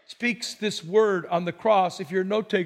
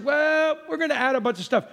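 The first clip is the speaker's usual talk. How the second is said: mock church voice; 6 semitones higher than usual. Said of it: "And the church says".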